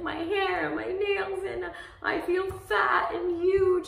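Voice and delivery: high, loud, hammed-up wailing complaint; imitated crying complaint. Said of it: "complaining and crying".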